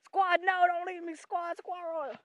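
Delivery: high-pitched cry to be waited for; hammed-up desperate wail; mock pleading. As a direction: voice the animal begging the squad. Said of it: "She's going".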